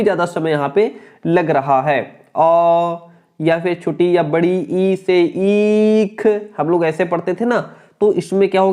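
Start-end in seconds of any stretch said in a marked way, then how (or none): 2.4-6.3 ई in ईख held long and slow; long vowel demo. Shown as a demonstration. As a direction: draw out the ई of ईख. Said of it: "अधिक समय लगा". (ईख में ई को लंबा खींचकर)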